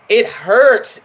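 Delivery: ordinary talk, nothing marked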